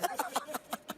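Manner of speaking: sudden high laugh, then quiet; high burst of laughter. Real, not acted. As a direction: let a high laugh escape, then soften. Host laughs at being teased.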